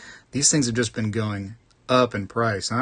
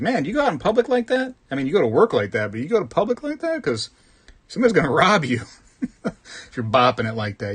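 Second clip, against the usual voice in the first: incredulous; 5 semitones higher, faster than usual. Retold or imitated. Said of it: "I was thinking, like".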